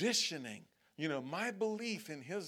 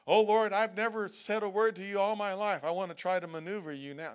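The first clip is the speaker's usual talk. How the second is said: a lightly voiced distant believer, raised and louder; mock prayer; 4 semitones higher, 7 dB louder than usual. A distant believer mock-praying to the Lord, louder. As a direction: pray it up and loud, then rush the end.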